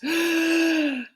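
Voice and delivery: big, long, sharp intake of breath; imitated huge gasp. Daughter showing how her dying father breathed, huge imitated gasp. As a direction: gasp in deeply as if the whole frame lifts.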